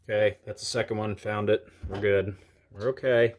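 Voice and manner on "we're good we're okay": usual speaking voice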